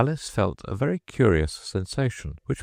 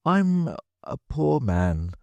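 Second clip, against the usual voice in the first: slow high pleading; 9 semitones higher, slower than usual. Acted. Hatter pleading again.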